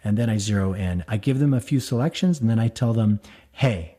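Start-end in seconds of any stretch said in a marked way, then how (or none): none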